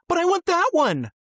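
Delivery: put-on high, whining little child; whiny kid. Kid insists on his lost balloon, high and whiny. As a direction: plead like a stubborn toddler.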